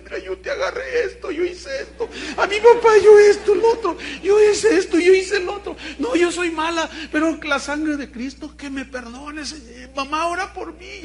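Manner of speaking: put-on high, loud tearful child's voice; a child sobbing out her wrongs; crying confession. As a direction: confess through sobs like a little girl.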